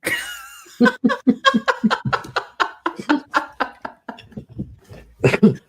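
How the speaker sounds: long high laughter; real extended laugh. Real, not acted. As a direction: laugh through the ending.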